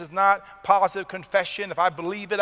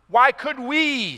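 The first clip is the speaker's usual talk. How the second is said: higher, louder, slow "Why could we?"; emphatic question; 6 semitones higher, 5 dB louder, slower than usual. Acted. Preacher voicing the disciples' emphatic question. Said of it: "it's emphatic in the original language".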